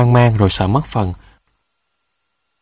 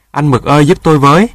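higher, louder call for help; panicked plea; 4 semitones higher, 5 dB louder, faster than usual. A narrator's reading. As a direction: plead in storybook alarm. Panicking rooster begs for help. (hốt hoảng cầu cứu)

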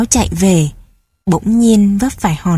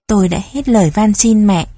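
usual speaking voice

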